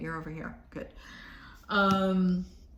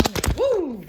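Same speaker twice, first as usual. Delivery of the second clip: sudden high, louder yelp; startled woo; 8 semitones higher, 6 dB louder than usual. Real, not acted. Reader startled by her outfit, yelping. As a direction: yelp as something slips. Outfit mishap mid-reading.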